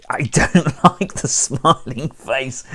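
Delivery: amused, laughing while speaking. Real, not acted. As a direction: talk through a laugh. Laughs at the creepy smiling face.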